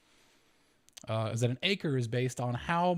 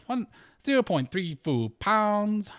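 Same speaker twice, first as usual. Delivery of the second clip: slow, pitch swooping up and down on every word; mock tonal singsong, much higher and louder; exaggerated sing-song tones; 7 semitones higher, 4 dB louder, slower than usual. Shown as a demonstration. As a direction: let the pitch bounce on "zero point three four pounds". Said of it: "My voice goes up and down".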